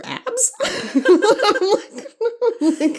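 high, squealing laugh. Real, not acted. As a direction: break into giggles mid-word. Laughing at her own guess.

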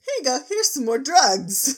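hammed-up high dealer voice; mock offer. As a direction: push the potion like a shady dealer. Zecora offering potion.

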